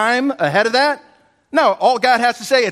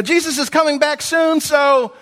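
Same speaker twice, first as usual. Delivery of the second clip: imagined Peter, mock; 6 semitones higher than usual. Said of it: "you might expect him to say".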